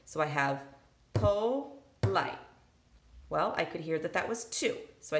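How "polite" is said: polite said beat by beat, po-lite; tapping out syllables. Shown as a demonstration. Teacher to students, polite tapped out syllable by syllable. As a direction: tap out each syllable of polite. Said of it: "tap my table".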